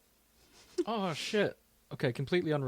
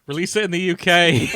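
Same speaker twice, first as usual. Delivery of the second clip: shouted, higher appeal; loud plea; 6 semitones higher, 14 dB louder than usual. Real, not acted. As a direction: call out to the director across the ocean. Appeal addressed to Taika Waititi.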